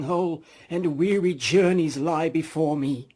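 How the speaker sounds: doom-laden spectre, stretch it out; ghost voice. Acted.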